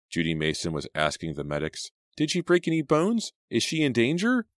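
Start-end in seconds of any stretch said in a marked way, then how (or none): 2.2-4.5 high, loud anxious question. A narrator's reading. Best friend anxiously asks the medics, high and loud. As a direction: press the medics, worried sick.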